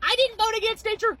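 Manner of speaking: high-pitched mock whine of denial; put-on high, whiny protest; mocking excuse. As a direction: squeal it like a whiny denier.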